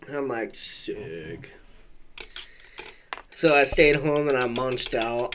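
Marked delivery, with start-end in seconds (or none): 0.5-1.5 a secret whispered sick; a kid whispering his delight after skipping school; whispered glee. Retold or imitated. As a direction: breathe it out so mom won't hear. Quiet glee after mom agrees.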